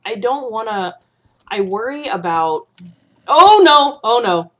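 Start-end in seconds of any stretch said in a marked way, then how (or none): 3.3-4.5 loud, high panicked yelp; sudden alarm at danger. Real, not acted. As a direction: blurt it out in a real fright.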